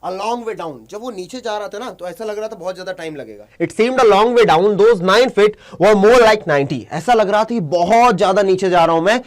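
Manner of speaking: normal talking voice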